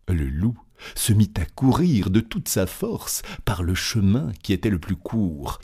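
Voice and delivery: ordinary delivery, nothing marked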